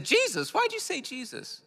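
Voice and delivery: mock the stranger's astonishment; surprised stranger. Imitating the stranger, baffled and high-pitched. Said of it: "He said".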